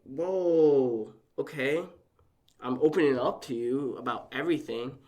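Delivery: loud, stunned retold reaction; retold shock. Son opening up to dad and rejected, retold shock. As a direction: protest loudly as if just hit. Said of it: "that hit me".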